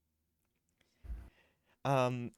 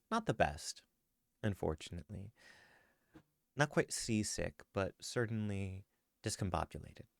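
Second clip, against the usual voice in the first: story narration; 3 semitones lower, 8 dB quieter than usual. Acted. GM narrates Squomo's scene.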